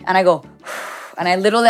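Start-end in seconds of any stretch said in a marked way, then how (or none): 0.7-1.1 long, audible demonstrative exhale; exaggerated breath out. Mother showing her son how to breathe, exaggerated exhale. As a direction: blow the breath out for show. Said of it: "exaggerated my breath".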